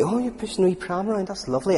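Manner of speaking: put-on high-pitched praise for a toddler; doting parent. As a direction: coo approval as an adoring parent, pitched well up. Acted parents praising toddler with pram.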